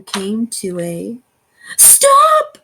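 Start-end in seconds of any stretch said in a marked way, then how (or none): 1.8-2.6 sudden high squeak; squeaky high stop. A narrator's reading. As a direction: make it pop up high. Narrator voices sudden stop.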